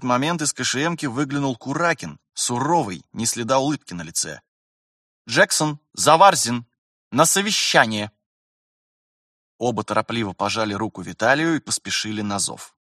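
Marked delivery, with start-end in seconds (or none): 5.3-8.1 raised, loud summons; calling out. A narrator's reading. Kurakin summons two officers to a meeting, calling out. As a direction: call them sharply to the meeting.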